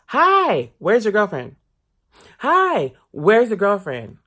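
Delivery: high, loud fake-sweet greeting of a nosy lady; sugary high mock greeting. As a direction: greet him brightly, then pry.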